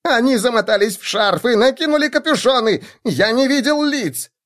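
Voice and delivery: high, frantic voice; high panicked halfling; squealing halfling. A narrator's reading. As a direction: plead for your life. Halfling keeps pleading.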